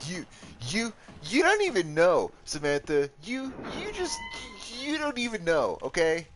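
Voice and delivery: mock yelling. Scolding Samantha in chat, hammed-up yelling. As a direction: sputter at her in playful fury. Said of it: "I'm sorry for yelling".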